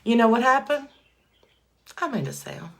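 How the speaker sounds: ordinary talk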